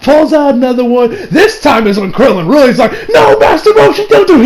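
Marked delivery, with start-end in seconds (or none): 3.1-4.5 high, shouted mock plea; urgent plea. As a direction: cry out to stop him. Krillin pleading with Roshi to stop.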